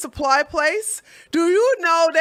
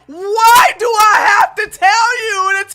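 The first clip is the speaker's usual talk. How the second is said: loud, high laughing disbelief; yelling through laughter; laughing shout; 8 semitones higher, 8 dB louder than usual. Retold or imitated. To the masked worker, shouting while laughing.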